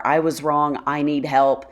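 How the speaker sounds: usual speaking voice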